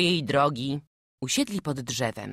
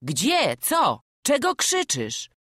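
rising, higher startled questions; 7 semitones higher, slower than usual. Acted. Traveler startled by the boy's shout, asking what happened. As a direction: react to a sudden cry.

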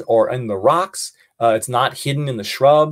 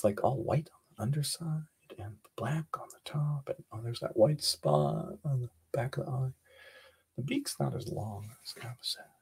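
leise geflüstert, wie ein Kind beim Beobachten; whispering; 2 semitones lower, 11 dB quieter than usual. Acted. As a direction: hush it, sharing a secret. Kids whisper observations to camera.

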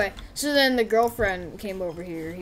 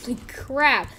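usual speaking voice

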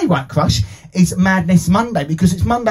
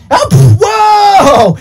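startled whoa; 10 semitones higher, 6 dB louder, much slower than usual. Pretend. Puppet pops up suddenly.

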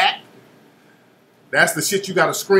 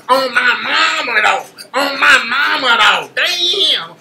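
raised, whiny hammed-up cry of "on my mama"; mock animated clowning; 4 semitones higher, slower than usual. Mocking the animated filmer, whiny and over the top. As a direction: overdo it like a clowning hype man. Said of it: "that old animated guy".

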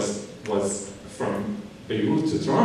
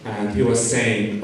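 ordinary delivery

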